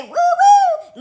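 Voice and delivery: very high, drawn-out whoop; granddad's whoop. Retold or imitated. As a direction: imitate granddad's gleeful hoot. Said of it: "He used to say".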